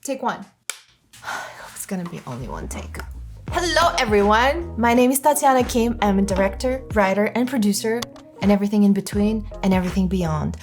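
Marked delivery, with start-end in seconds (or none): none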